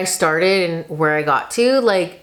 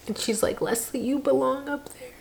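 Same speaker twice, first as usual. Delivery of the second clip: tearful; 6 semitones higher, 9 dB quieter than usual. Real, not acted. Crying while quoting friend.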